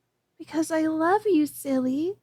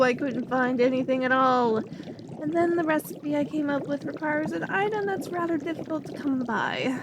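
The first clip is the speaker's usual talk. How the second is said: normal talking voice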